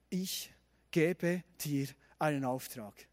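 slow, low, hushed pronouncement; solemn divine commission. A narrator's reading. God commissions Gideon in the story, solemn and slow. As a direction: give each word room, softly and gravely.

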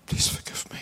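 barely breathed, no voice at all; whisper. Retold or imitated. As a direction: whisper the plea to God. Dying man praying for forgiveness. (a hushed prayer whispered from a hospital bed)

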